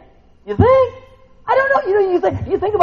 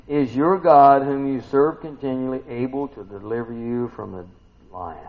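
ordinary talk, nothing marked

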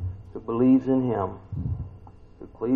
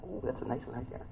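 soft, wistful murmur over donuts; hushed admiring murmur; 15 dB quieter than usual. Retold or imitated. Preacher imitates people eyeing donuts, softly murmuring. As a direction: murmur to yourself, eyeing a treat. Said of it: "They go".

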